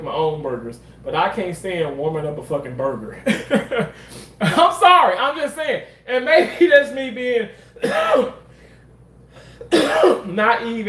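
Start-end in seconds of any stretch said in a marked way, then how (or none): 3.3-3.9 burst of laughter. Real, not acted. Laughs at her own rant. 4.4-5.8 laughing apology. Real, not acted. Laughing apology after the rant. 6.1-10.9 laughing through words. Real, not acted. Laughs mid-sentence.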